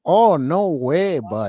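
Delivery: put-on parroting the miners in a Canadian accent. Host copies the clip's miners in mock Canadian accent.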